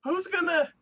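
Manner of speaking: high-pitched retold distress, no real tears; mock upset protest. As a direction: imitate a girl about to cry. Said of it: "And she starts crying".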